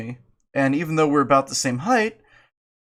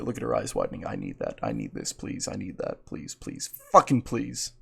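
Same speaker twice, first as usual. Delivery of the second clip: deep growly begging; 9 dB quieter than usual. Pretend.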